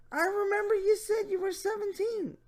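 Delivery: high mock leer; Jeff Stacy voice. Reading the predator's question, high mocking voice. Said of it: "my Jeff Stacy voice".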